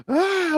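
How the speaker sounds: a sharp, high gasp caught mid-sob; imitating a crying gasp. Actress imitating herself breaking down on set, with a high gasp. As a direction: gasp as if you can't stop crying. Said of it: "and you're just like".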